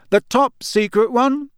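a pompous, slow, loud hint. A narrator's reading. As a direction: raise the voice to be overheard. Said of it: "He raised his voice slightly".

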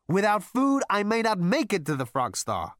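loud, high protest; insistent retort. A narrator's reading. Zaphod defends his worry about food, insistent.

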